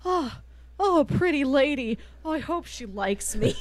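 high, shy lovestruck murmur; a lightly voiced blushing king; bashful king. The king blushes as he meets the queen, high and shy. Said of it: "blushes, just like".

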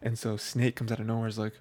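usual speaking voice

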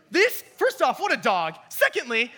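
high, loud teasing jab; mock-outraged teasing; playful outburst. Real, not acted. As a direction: rib him with delight. Excited jab at the student.

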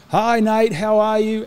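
high, bright call to his son through the door; dad calling home to his young son, high cheery call. Retold or imitated. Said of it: "I would actually call out and say".

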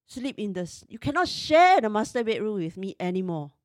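half-shouting the accusations, slowed at the peaks; retold scream. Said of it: "I screamed at him".

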